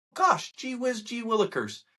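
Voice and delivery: high mock-naive "gee willikers"; sarcastic gee whiz. Mocking the media's blindness, high sarcasm. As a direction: play dumb with sarcasm.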